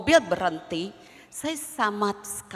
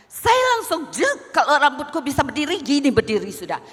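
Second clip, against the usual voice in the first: exploding anger; 6 semitones higher, 8 dB louder than usual. Retold or imitated. Describes anger boiling over.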